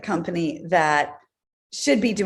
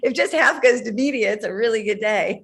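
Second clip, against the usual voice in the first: laughing; 5 semitones higher than usual. Real, not acted. Joking about media costs.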